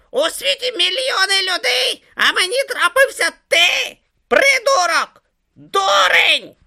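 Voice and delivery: shrill, loud enraged screeching; furious insults. A narrator's reading. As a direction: hurl abuse in a high, frantic shriek. Om rages and insults Brutha.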